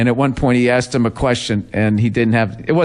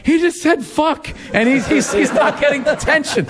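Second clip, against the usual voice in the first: high, giggling retold thought; laughing disbelief; 14 semitones higher than usual. Recalling the classroom moment, high and laughing. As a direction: laugh through the shocked aside. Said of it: "we were just sitting there like".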